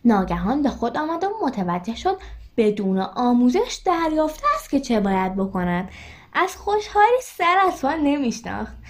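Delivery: plain reading voice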